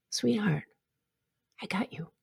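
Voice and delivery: very soft, soothing words with pauses; tender reassurance. Acted. Speaker holding space for her past self, tenderly reassuring. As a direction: gently comfort a frightened younger self.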